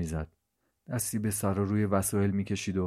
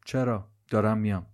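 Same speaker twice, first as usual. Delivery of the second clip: plain reading voice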